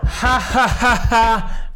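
high, jeering fake laugh and taunt; exaggerated playground-style jeer; mocking imitation. Mocking the people who laughed.

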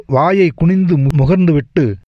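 ordinary delivery, nothing marked

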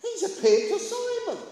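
high, loud burst of news; excited announcement. Acted. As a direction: proclaim astonishing news, pitched high. Acting out disciples' amazed news.

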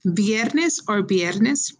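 viernes first with a V, then with a B, drawn out slowly; teacher showing both accepted sounds; V versus B sounds. Shown as a demonstration. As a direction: say viernes once with a V, then again as biernes. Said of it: "with a V as in Victor or as a B as in boy".

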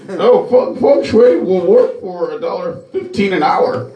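high mimicking voice, talking in third person; high caricature of a self-announcing handyman; Feng Shui. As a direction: parody an acquaintance praising himself, pitched high. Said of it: "speaks to themselves in a third per".

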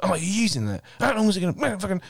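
high hurried mock complaint; mimicking impatient customers. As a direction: ape the annoyed customers, speeding up. Imitating annoyed laundrette customers.

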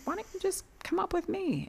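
high, soft sister's voice offering; sister inviting. Retold or imitated. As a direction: gently invite her little sister along. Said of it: "she's like".

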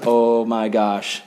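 slow, low acted amazement; hushed-down, drawn-out disbelief; stunned awe. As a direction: draw it out low, as if struck with awe. Priest amazed at walking on water.

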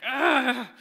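mock toddler "ugh" of frustration; imitated frustrated groan. Dad imitating daughter struggling with Legos, frustrated groan. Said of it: "making sounds of frustration".

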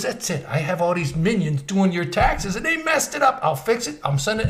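high, worked-up fussing impression; Larry David. Accountant fixing botched taxes on phone, high Larry David impression. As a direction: impersonate a flustered boss, pitched high. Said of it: "just like in Larry David's voice".

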